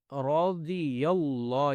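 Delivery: اللہ with a thick, stretched laam; slow tajweed reading of رضی اللہ; heavy laam. Shown as a demonstration. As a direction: join رضی into اللہ slowly. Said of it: "لام کو موٹا بھی کرنا ہے".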